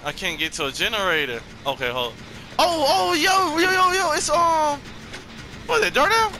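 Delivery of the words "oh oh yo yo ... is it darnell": much higher, crying-out panic; frightened squeals while hiding; high whimpering fear; scared. Real, not acted. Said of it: "I feel so scared".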